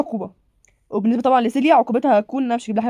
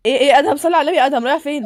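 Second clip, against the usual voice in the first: put-on calling after a running kid, louder; 3 semitones higher, 5 dB louder than usual. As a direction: raise the voice to stop him. تنادي على طفل يجري. (تنادي بصوت عالي على أدهم)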